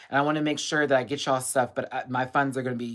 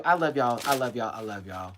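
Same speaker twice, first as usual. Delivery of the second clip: fading tender. Real, not acted. Tender sign-off.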